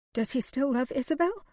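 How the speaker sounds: high, breaking voice; voice cracking. A narrator's reading. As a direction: let the voice crack. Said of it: "her voice cracking".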